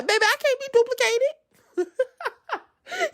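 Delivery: high, slow, squeaky mock sass; playful boasting. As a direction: play it up in a drawn-out high voice. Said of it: "Y'all know I play all day".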